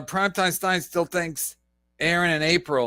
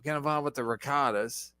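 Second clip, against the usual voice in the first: lower, mock scheming voice; imitating Aaron; 7 semitones lower than usual. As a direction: voice him as a sly schemer. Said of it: "Maybe he's like".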